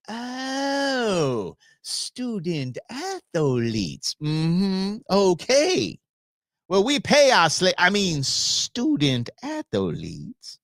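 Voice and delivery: drawn-out mock Southern accent; Cartman. Cartoon character answering the head of Colorado, slow mock Southern accent. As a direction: imitate a Southerner, stretching the words very slowly. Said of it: "he's talking in a Southern accent".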